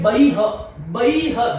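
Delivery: बही ह with a separate "ha" tacked on; wrong visarga. Retold or imitated. Teacher imitates some people's error, "bahi-ha". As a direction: finish बही ह on "ha" where "hi" belongs. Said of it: "कुछ लोग इसको बोलते हैं".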